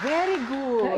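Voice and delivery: high, loud mock teacher saying "Very good"; teacher praising. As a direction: play the pleased teacher handing out praise. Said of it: "Sabi ng teacher".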